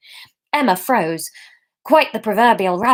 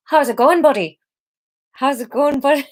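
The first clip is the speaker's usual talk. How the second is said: higher, shaky attempt at Irish; attempted Irish accent; 5 semitones higher than usual. Theo greeting the other man, tentative Irish accent. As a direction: try out a friendly Irish greeting. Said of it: "okay, Irish".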